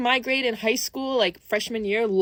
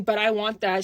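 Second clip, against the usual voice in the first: normal talking voice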